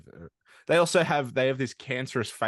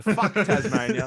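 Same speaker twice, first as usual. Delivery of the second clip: laughing, louder and higher; 6 semitones higher, 6 dB louder, slower than usual. Shown as a demonstration. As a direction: crack up while saying it. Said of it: "That's the hot take for this episode".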